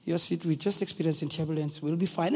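soft, polite announcement voice; nice cabin attendant. Acted. Cabin attendant asking passengers to sit, nice and soft. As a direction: soothe them softly and courteously. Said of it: "they speak in a nice way".

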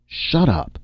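sharp, high-pitched outburst; sudden high snap; snapping. A narrator's reading. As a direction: cut them off angrily. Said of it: "Saint Cyr snapped".

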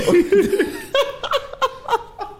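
long, high-pitched laughing fit. Real, not acted. Bouncer finishes the Taco Bell punchline, long high laughter. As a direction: crack up at length.

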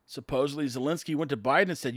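normal talking voice

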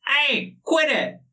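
high, slow, indignant little voice; squeaky dagger voice. Acted. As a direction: complain like an annoyed tiny creature. Voice of talking dagger.

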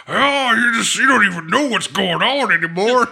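high, cranky mock old man. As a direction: imitate a grumpy old man scolding. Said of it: "all these little kids to talk to".

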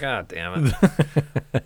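slow, loud drawn-out groan; dismayed groan. Retold or imitated. Told he has many cavities, slow loud dismay. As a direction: drag it out in mock despair.

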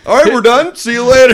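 high loud mock farewell; mock quitting shout. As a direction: yell it like storming off. Imitating friend quitting game.

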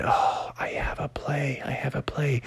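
breathless unvoiced whisper; Bubby realizing he has a move, whispered. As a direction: whisper it to yourself. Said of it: "Bubby said".

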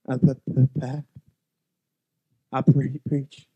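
low, hesitant, halting admission; sheepish stammering voice; ashamed stammer. Retold or imitated. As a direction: stammer out the shameful confession.

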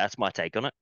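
normal talking voice

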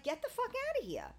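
high-pitched retold disbelief; incredulous outburst. Friend reacting to a sick friend's news, incredulous.

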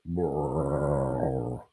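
low rumbling "brrr" of a gurgling stomach; mock belly gurgle; stomach rumble. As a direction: imitate a sudden gut rumble. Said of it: "your stomach will go".

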